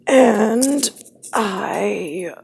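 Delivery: ordinary talk, nothing marked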